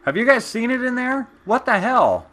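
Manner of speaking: high, loud mock outrage at the mess; faked shock. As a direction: play innocent with loud, high fake surprise. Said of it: "say something like".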